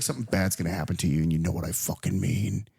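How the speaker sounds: low, hushed threat; mock gangster threat. Host voicing boss warning Loretta, low hushed threat. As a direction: drop low and lean into the menace.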